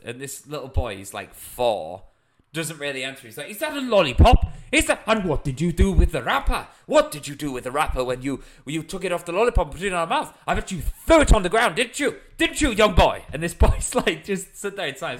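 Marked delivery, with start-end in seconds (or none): 3.5-13.2 high, rapid-fire mock interrogation; imitated scolding. Landowner questioning a young boy about his lollipop, imitated indignant scolding. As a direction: tell off a child like an outraged landowner. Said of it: "he goes to this young boy".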